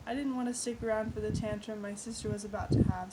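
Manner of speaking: plain reading voice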